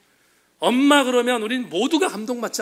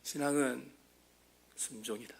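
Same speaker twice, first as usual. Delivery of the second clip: "신앙은 순종이다" dropped to a near-whisper, much softer and lower; hushed echo; 6 semitones lower, 12 dB quieter than usual. Real, not acted. Preacher quietly repeating the lesson to the congregation, hushed. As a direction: let "신앙은 순종이다" fall almost silent after the cry.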